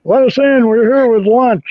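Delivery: high, slow, overly friendly lunch delivery; delivery worker voice. Pretend. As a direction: put on a cheery fake delivery guy. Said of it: "act like".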